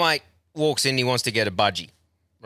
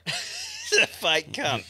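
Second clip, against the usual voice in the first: laughing mock quote; much slower than usual. Laughing while quoting own thought.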